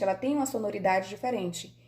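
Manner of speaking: ordinary talk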